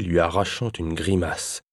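ordinary delivery

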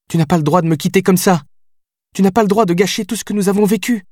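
high desperate plea to the man walking out; pleading outburst. Acted. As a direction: grab him with the words, don't let him leave. Billy grabs his arm, pleading.

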